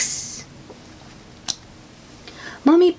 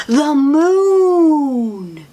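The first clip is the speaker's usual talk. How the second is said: high, loud, drawn-out wonder; amazed; 8 semitones higher, 5 dB louder than usual. Acted. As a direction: gasp it out in awe. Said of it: "says Peppa".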